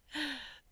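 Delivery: short high taunting laugh; mocking laugh. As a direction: laugh down at him.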